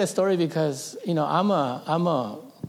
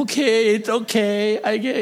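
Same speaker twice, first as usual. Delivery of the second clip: put-on higher, louder childlike comforting; soothing kids; 8 semitones higher, 4 dB louder than usual. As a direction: voice the siblings consoling him. Siblings comforting the boy.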